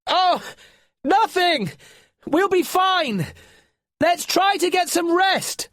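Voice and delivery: high, loud reassuring yell. Acted. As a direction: brush it off, shouting over the wind. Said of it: "yelled Finn".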